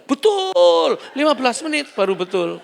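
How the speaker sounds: shrill-high triumphant "betul"; excited mock woman. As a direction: squeal it out high and loud. Woman caller's triumphant answer. (wanita menjawab girang setelah lama: betul)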